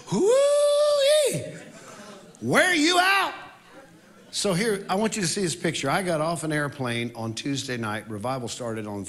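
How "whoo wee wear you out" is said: high, loud whooping shout. Real, not acted. Worship leader shouting joy to the congregation. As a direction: shout it up high to wake the room.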